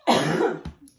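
loud choking cough; coughing up water. Real, not acted. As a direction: cough as if it went down the wrong pipe. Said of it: "That went down the wrong pipe".

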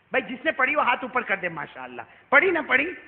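loud, high, fast rousing sermon; preaching at full force. Acted. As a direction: fire up the congregation, pushing the pace. Preacher rousing crowd about Eid. (زور دار تقریر، تیز اور بلند آواز)

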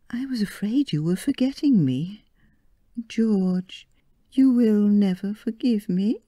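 pleading bride. Acted. Marion pleading with George.

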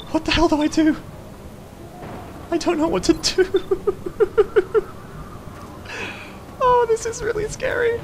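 very high panicky squeak; terrified gibbering. Pretend.

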